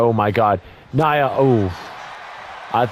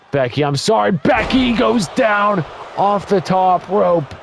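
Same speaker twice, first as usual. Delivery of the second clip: excited call; 8 semitones higher than usual. Real, not acted. Top rope bump called out.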